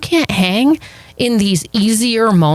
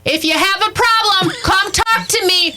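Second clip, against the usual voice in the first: pretend yell; 11 semitones higher than usual. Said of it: "yell out".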